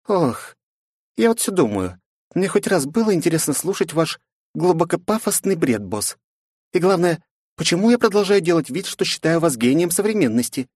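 high, mocking girl voice; sarcastic young woman. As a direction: tease the boss in a high voice. Narrator voices Flow.

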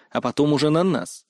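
ordinary delivery

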